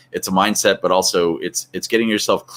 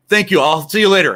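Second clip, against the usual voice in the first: higher, louder hammed-up quick goodbye; mock brush-off; 11 semitones higher, 6 dB louder than usual. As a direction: hang up on him cheerfully. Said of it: "And he goes".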